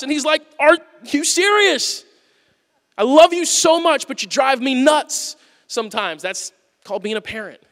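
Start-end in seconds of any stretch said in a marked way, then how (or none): 0.6-6.2 slow, incredulous father voice; lovingly fed up; exasperated father. Pretend. God answering his people, slow and exasperated.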